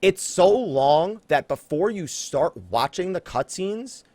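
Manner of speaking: usual speaking voice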